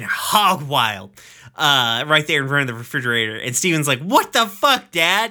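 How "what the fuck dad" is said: high, loud disbelieving cry; shocked yell. Retold or imitated. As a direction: blurt it out in shock at dad. Steven shocked at dancing dad.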